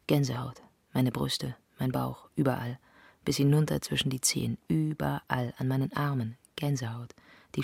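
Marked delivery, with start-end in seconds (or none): none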